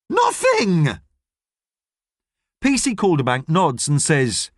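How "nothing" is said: indignant denial. Acted. Ellie denies accusation.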